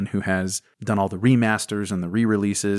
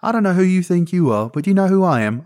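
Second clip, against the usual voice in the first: high, loud indignant English toff; estuary english accent; 10 semitones higher, 9 dB louder than usual. Pretend. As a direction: demand it like an offended Brit. Mock English quarrel.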